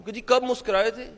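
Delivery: ordinary talk, nothing marked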